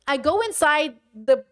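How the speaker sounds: usual speaking voice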